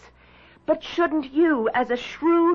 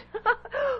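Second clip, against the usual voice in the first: scoffing laugh; 9 semitones higher, 5 dB quieter than usual. Acted. Scoffing at haunted house talk.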